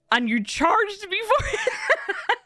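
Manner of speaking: very slow, high, laughing disbelief; incredulous laugh. Retold or imitated. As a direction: stretch it out high through a laugh.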